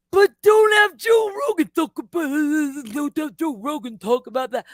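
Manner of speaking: high, put-on mocking voice, loud at first; mocking silly voice. Mocking critics of Joe Rogan in a silly put-on voice. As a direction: sneer it in a goofy caricature voice. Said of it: "if I use that voice".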